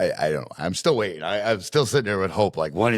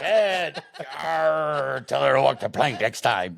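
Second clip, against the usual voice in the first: playful pirate voice; 8 semitones higher, slower than usual. Retold or imitated. Kid's pirate joke.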